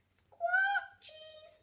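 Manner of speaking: loud squawk, then small high word; squawk, then echo it high like a parrot; parrot mimicry. A narrator's reading. Parrot copies Grampy Rabbit's order, high squawky mimicry. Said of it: "Polly Parrot copied".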